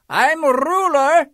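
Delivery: high, slow, pompous turtle king; King Yertle. A narrator's reading. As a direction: lord it over the pond from your throne. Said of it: "says Yertle".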